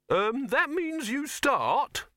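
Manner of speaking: very high, slow, patient voice. A narrator's reading. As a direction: explain it slowly, pitched high. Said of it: "said Silverfish patiently".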